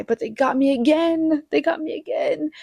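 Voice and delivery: high, rising mock despair; high-pitched, half-laughing defeat; exasperated laugh. Real, not acted. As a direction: throw your hands up at being beaten. Admitting she cried again.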